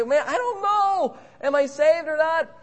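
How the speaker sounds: high fretful believer voice; anxious doubter. Pretend. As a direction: whine out the worry.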